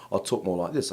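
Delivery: a light London accent, the way he used to talk; old London accent. Shown as a demonstration. Rabbi shows friends how he talked in London, light London accent. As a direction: slip back into his old London accent. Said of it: "I was very London".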